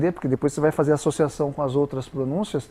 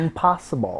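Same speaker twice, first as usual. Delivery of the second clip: impossible, said the American way. Retold or imitated. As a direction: say impossible as an English speaker would. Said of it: "o gringo diria assim".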